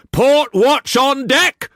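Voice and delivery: high, slow, loud bellow; shouted order. A narrator's reading. Brock shouting orders to the crew, loud. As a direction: call it down the gangway. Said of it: "Brock shouted".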